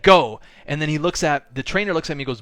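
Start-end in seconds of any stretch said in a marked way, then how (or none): 0.0-0.4 short, very loud "Go"; shouted command. Retold or imitated. Trainer signalling the second choke, shouted. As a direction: bark the start signal. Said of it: "He's like".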